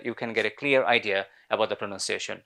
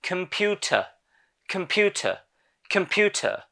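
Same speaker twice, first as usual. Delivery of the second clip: British pronunciation; 6 semitones higher than usual. Shown as a demonstration. Teacher drills the word for learners, British accent. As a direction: model a British accent, crisp t, silent final r. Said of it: "British ইংলিশে রটাকে উচ্চারণ করা হয় না".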